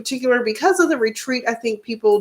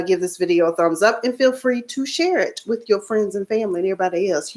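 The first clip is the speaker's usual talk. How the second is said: normal talking voice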